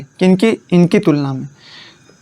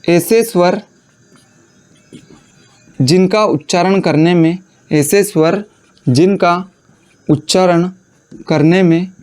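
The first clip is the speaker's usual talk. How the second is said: dictation. Acted. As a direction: read it out so students can write. Dictating a definition for notes. (रुक-रुक कर notes लिखवाना)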